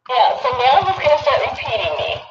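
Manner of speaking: high-pitched doll echo; like a talking toy repeating; toy playback. Real, not acted. Toy doll plays back her words.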